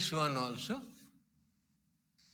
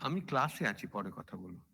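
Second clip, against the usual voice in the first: quiet aside; 11 semitones lower, 9 dB quieter, much faster than usual. Real, not acted. Aside into phone during class.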